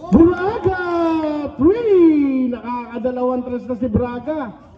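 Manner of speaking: stretched-out names and score calls; live play-by-play. Real, not acted. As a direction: call each basket like a courtside announcer.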